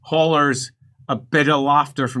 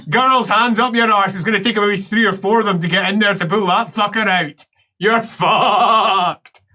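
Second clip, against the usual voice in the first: higher, gleeful taunting voice; heckling bloke; 7 semitones higher than usual. Acted. Man behind partition mocking patient, acted gleeful heckling. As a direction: revel in another man's doom. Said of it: "a deep-throated laughter".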